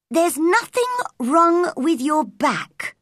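high, firm, cross verdict; crossly spoken. A narrator's reading. Girl has checked the boy's back, speaking crossly. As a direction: tell him crossly and firmly. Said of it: "crossly spoken".

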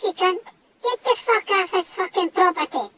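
normal talking voice

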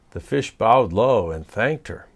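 ordinary delivery, nothing marked

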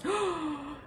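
sharp gasp of wonder, breathy and soft; awed soft gasp. Real, not acted. Tarot reader gasping softly at the cards. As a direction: catch your breath in awe.

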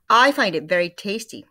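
loud, smug Missus Twit; smug wife. Acted. Missus Twit watches her husband eat worms, loud smug voice. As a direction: savor the lie smugly. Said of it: "Missus Twit said".

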